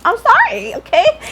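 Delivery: high, sassy mock-sorry; playful sassy I'm sorry; sassy apology. Real, not acted. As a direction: throw the apology out with attitude. Sassy aside to viewers.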